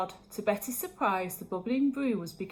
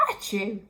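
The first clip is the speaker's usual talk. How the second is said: a big playful sneeze; 2 semitones lower than usual. Acted. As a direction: sneeze it out: Achoo. Betty sneezes in story.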